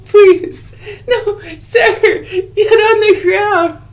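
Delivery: high squeaky voice through laughter, slow; Police Academy impression. Imitating the timid Police Academy officer, squeaky and laughing. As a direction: squeal it like the timid recruit, giggling. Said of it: "Remember Police Academy?".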